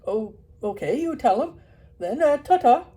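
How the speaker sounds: loud cheery Yorkshire farewell; Yorkshire farmer. Acted. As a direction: wave off like a dalesman. Farmer leaves.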